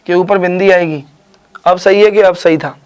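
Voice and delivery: ordinary talk, nothing marked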